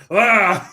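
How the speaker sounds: loud, high comic "ah" cry; mock shriek. Imitating the shirt being opened for co-host, mock shriek. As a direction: let out a sudden imitated scream. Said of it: "goes like this".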